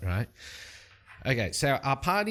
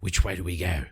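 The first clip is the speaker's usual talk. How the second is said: a lower, steady adventurer's voice; Light's low voice; 4 semitones lower than usual. Acted. Light asking the party which way to go, in a low voice. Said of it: "Light turns to Nalthor and says".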